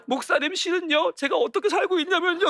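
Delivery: high-pitched imitation of a child confiding; child pouring out troubles. Child telling the pastor about their hard life, high pleading imitation. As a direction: act out a kid earnestly confessing, pitched high. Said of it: "눈물 없이 이야기하는 아이가 한 명도 없어요".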